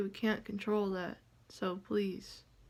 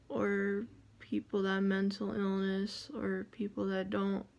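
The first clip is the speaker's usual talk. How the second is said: ordinary talk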